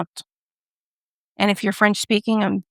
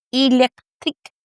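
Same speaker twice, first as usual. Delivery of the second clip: attempted French; 3 semitones higher than usual. Shown as a demonstration. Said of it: "or as we in America say".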